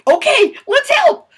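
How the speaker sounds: put-on very high, loud, slow child voice; eager kids. Kids agreeing to help their mom, very high and loud. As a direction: cheer it like excited little kids. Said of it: "the kids were like".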